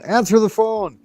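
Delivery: very high parrot command; parrot imitation. Parrot ordering people to the phone, high imitation. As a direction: call it high like a mimicking parrot. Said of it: "they repeat what they hear".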